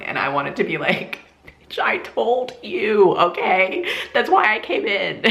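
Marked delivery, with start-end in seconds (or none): 1.5-5.2 higher, indignant told-you-so; retold upset outburst. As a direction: act out vindicated outrage with a laugh creeping in. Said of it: "I was so upset at that point".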